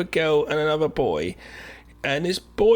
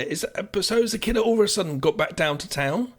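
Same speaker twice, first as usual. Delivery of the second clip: usual speaking voice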